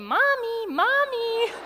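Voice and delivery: put-on very high, loud child's call; toddler calling mommy. Toddler trapped in the van calls for his mother, high and loud. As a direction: cry out for mommy like a stuck little boy. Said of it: "He told us later".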